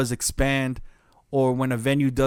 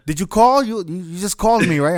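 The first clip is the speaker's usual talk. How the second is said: higher, louder pushy hustler voice; mock club dealer; 5 semitones higher, 4 dB louder than usual. Club dealer offering drugs to the DJ, mock hustler voice. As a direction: play the dealer at the club, eager.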